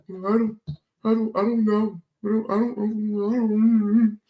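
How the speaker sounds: high, soft, far-off stammering mock of a clueless manager; dumb stammering manager. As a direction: play the manager as a clueless fool tripping over "I don't". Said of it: "he looking just as dumb as".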